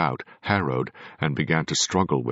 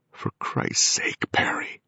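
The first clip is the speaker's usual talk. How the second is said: slow, shaken whisper; trembling whisper; slower than usual. A narrator's reading. The son whispering at the medium in fear, whispered. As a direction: hiss his name in shock. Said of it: "Richard's voice was trembling".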